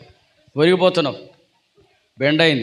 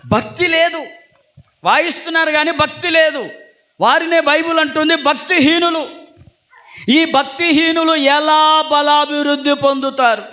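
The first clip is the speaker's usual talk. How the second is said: preaching; 10 semitones higher than usual. Acted. Pastor preaching to the congregation, fervent and high. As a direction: preach it up to the rafters.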